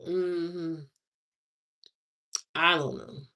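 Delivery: usual speaking voice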